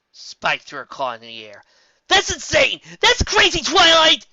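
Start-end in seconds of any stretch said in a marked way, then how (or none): 2.1-4.3 high, loud frantic shout; frantic yell. A narrator's reading. Spike shouting at Twilight in disbelief.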